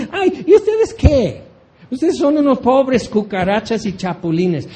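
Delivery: scornful God. Acted. God laughing at the peoples against him, high mocking voice. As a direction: sneer at the little cockroaches, laughing. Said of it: "Y él dice".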